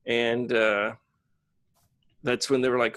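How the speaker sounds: ordinary delivery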